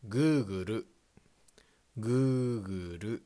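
"Google" as goo-goo-ru, two even goo syllables then ru; Japanese pronunciation. Shown as a demonstration. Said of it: "In Japanese".

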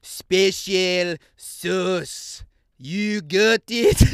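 very slow, loud, word-by-word put-on accent. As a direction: spell it out slowly and loudly, accented. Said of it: "this time speaking slower and louder".

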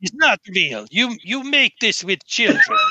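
scornful bouncer, a bit Russian; a hint of a Russian bouncer mocking a fake ticket. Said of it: "he sounds Russian".

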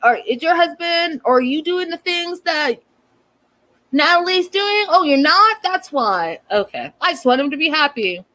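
prissy and superior, imitating Trish's reply to Yara; hoity-toity. A haughty mother-in-law snapping back at Yara, mocking hoity-toity. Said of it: "gets all, like, hoity-toity".

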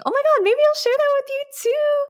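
very high, fast excited aside; excited squeal. Real, not acted. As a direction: blurt it in a sudden high-pitched rush. Sudden excited idea mid-story.

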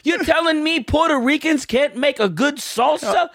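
high, indignant New York caricature; New York accent. Acted. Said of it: "going all in on stereotypes".

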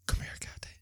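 softly whispered plea to come closer; dying man whispering to wife; deathbed whisper. Retold or imitated.

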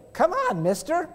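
put-on high child's voice; mild high little boy coaxing. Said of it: "little boy again".

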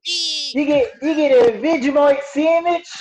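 put-on co-host joins the bit with an Australian accent. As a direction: play the Aussie butler offering food. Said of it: "That's his best accent".

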